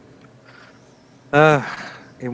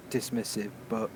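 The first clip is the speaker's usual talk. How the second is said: usual speaking voice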